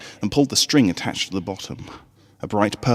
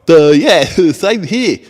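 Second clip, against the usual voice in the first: goofy colt voice; 9 semitones higher, 11 dB louder, slower than usual. A narrator's reading. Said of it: "Snails added".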